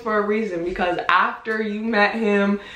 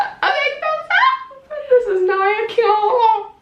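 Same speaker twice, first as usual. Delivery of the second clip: much higher, slow sign-off; slow outro; 14 semitones higher, slower than usual. Acted. Ending the video for viewers, slow high sign-off. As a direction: stretch out the outro. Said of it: "outro sign-off".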